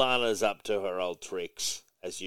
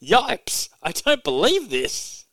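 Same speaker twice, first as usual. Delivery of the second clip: high, loud, slow cartoon dog yelp; hammed-up dismay from a super dog; shocked Crypto; 10 semitones higher, 6 dB louder, much slower than usual. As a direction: squeak it out like a startled cartoon pup. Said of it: "Crypto's behind him going".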